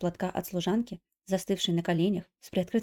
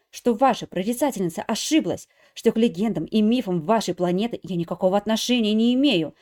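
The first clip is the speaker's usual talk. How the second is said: loud, building angry scolding; furious tirade; 6 dB louder than usual. Acted. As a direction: lash out at him in fury. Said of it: "нервы не выдержали, набросилась". (яростная отповедь)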